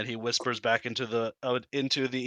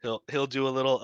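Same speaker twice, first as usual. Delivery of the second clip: usual speaking voice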